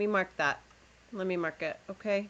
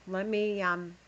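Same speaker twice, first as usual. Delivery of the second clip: ordinary talk, nothing marked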